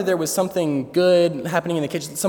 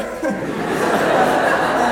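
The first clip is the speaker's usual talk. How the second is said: high breathy burst of laughter; cracking up at his own story; real laugh; 8 semitones higher than usual. Real, not acted. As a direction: break up laughing.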